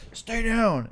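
high, loud shouted order; police command. Acted. Cop to man pinned on the ground, shouted command. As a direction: shout it down at a suspect. Acting police pinning him down.

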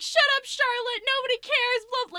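high, loud mocking yell; imitating a yell. Charlotte imitating Tyson yelling at her, mock shout. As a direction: snap it back in a mock shout. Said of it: "started yelling at me".

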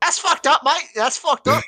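high, loud, hammed-up Australian accent; attempted Australian accent. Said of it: "about to do an Australian accent".

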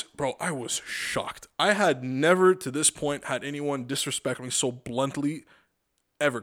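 usual speaking voice

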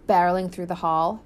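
ordinary delivery

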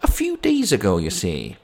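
sceptical policeman. Acted. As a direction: echo her answer as a doubting copper. Policeman repeats her answer.

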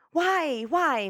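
normal talking voice

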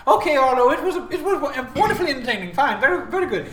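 high, loud, showy character voice; theatrical game host. Acted. Host calling the act entertaining, loud theatrical voice. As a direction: hurry past the praise in a hammed-up high voice.

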